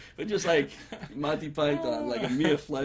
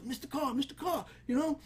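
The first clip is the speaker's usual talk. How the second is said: put-on high, quiet, pleading kid voice; eager guy begging for a minute; insecure young guy voice; 10 semitones higher, 5 dB quieter than usual. Guy pleading softly to neighbor for attention. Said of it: "So he goes".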